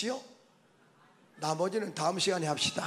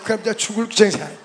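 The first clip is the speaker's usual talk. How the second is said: ordinary delivery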